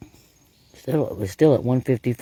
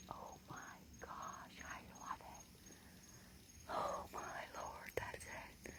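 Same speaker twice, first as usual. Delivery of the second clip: barely audible slow thrilled whisper; excited whisper; 28 dB quieter, slower than usual. Real, not acted. Investigator in the woods after the vocals, awed whisper. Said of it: "I'm so excited right now".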